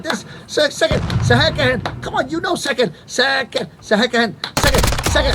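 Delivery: imitating his frantic repeating; mock pleading. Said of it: "I was saying to him again and again".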